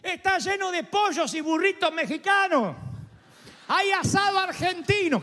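high, shouted call from outside the walls; lepers shouting. Acted. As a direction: yell it over the city wall. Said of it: "gritan de afuera y dicen".

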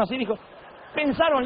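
ordinary talk, nothing marked

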